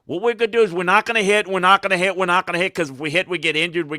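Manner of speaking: mocking coach talk. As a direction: parrot the no-hitting line with scorn. Mocking football's no-contact approach.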